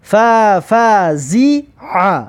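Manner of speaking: very slow, drawn-out syllables; slow teacher's model reading. Shown as a demonstration. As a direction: stretch each syllable for learners to copy. Teacher models the blended word.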